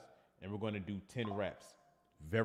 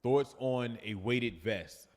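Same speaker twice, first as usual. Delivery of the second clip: slow reading; 4 dB louder, much slower than usual. Shown as a demonstration. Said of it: "reading viewer question aloud".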